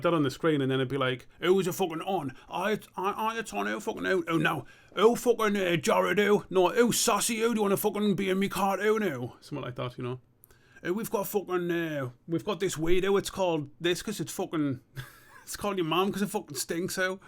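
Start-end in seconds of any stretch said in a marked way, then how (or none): 1.4-9.3 high-pitched, put-on cartoon voice; cartoon character voice. Acts out imagined cartoon caller. 10.9-17.2 put-on cartoon voice, pitched up; sweary cartoon skit, breaking into a laugh; cartoon character voice. Continues the imagined cartoon skit.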